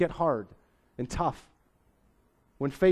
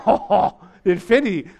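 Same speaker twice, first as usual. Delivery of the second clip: higher, louder mock amazement; mock awe; 4 semitones higher, 7 dB louder than usual. Kids hear love reaches infinity, acted amazement. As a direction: gasp it out like an impressed kid.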